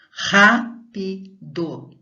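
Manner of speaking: "Rápido" loudly and slowly with a throaty initial R; slow loud teacher demo of a strong R. Shown as a demonstration. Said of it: "então é forte".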